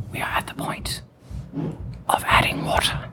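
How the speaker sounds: ASMR whisper; breathy whisper to a partner. Acted. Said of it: "A S M R".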